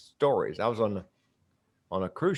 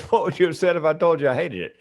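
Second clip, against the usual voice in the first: lightly acted passenger, higher pitch; voiced fellow passenger; 10 semitones higher, 5 dB louder than usual. Woman teasing the comedian at breakfast, lightly imitated higher voice. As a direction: retell her comeback pitched up and a touch louder. Retold quip from a cruise passenger.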